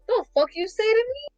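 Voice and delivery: high-pitched mock outrage; imitating an offended Jamaican. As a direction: challenge them in pretend indignation. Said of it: "the Jamaican be like".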